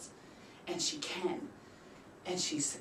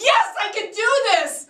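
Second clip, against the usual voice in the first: high, loud triumphant yes; triumphant cheer; 14 dB louder than usual. Retold or imitated. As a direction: celebrate the breakthrough. Triumphant inner quote.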